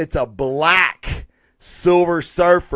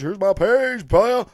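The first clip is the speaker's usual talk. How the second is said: "Here's my page, player" lifted a touch higher, cocky; playful swagger; 4 semitones higher than usual. Pretend. Host shows viewers his page, playful swagger. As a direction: show off the page like a hustler.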